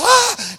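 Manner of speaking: high, loud mock scream of fright; short hammed-up shriek of fear. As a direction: yelp like a startled disciple seeing a ghost. Disciples think they see ghost.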